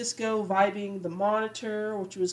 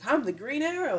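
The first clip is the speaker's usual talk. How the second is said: mock proud boast, pitched higher; 7 semitones higher than usual. Barry bragging to Diggle about being the Green Arrow, proud. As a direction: puff up a little, pleased with himself. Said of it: "like, so proud of it".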